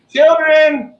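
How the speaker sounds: loud call across the house. Real, not acted. Calling kids to the camera.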